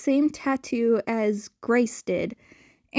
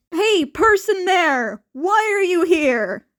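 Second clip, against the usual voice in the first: practically yelled; 6 semitones higher, 5 dB louder than usual. A narrator's reading. Demigod boy calling out to a lurking stranger, loud. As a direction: call out sharply at the stranger. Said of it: "practically yelled".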